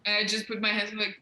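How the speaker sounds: normal talking voice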